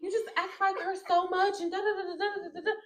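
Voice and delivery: gushing mom comparing her; imitating the mom. The mom comparing her to the daughter, lightly imitated. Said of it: "She's like".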